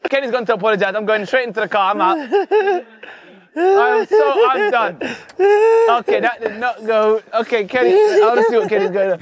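loud, laughing disbelief; loud and worked up after a prank backfires; flustered exclaiming. Real, not acted. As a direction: blurt it out loudly, half laughing.

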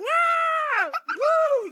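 high, loud drawn-out shriek; hammed-up panicked wail; mock scream. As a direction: scream as you flee. Goldilocks runs away screaming.

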